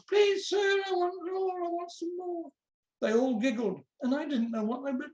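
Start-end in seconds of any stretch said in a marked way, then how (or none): 0.1-2.5 mock chorus. A narrator's reading. Boys teasing a puzzled classmate with Oliver's line, mock chorus. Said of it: "there was a whole chorus".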